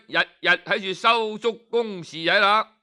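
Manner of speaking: ordinary delivery, nothing marked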